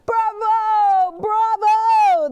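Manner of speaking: loud high cheering shout; cheering crowd. Acted. Students cheer Officer Buckle, loud high shout. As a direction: cheer it out to the stage.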